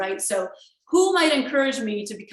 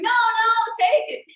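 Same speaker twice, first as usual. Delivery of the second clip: high, lilting "no, no, take it"; sing-song insistent friend; 13 semitones higher, 6 dB louder, slower than usual. Retold or imitated. The nice friend insisting on paying, sung high. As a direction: sing it out like a pushy generous friend. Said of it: "He's like".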